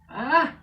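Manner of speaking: sudden high loud scream; startled scream. Acted. Dara nearly hitting a rock, startled scream. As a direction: cry out at the rock.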